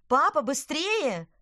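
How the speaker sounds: high, loud, slow urgent call; shouting. Acted. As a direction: yell at dad to hurry. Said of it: "крикнула Лина".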